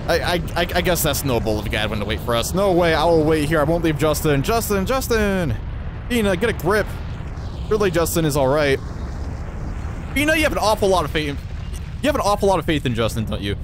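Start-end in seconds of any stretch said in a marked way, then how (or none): 2.5-13.6 lightly raised, dramatic character read; voicing game characters. Streamer reading the party's dialogue aloud, lightly dramatized. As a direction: act out the heroes' lines with some urgency.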